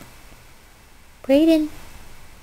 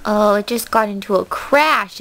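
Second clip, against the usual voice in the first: ordinary talk, nothing marked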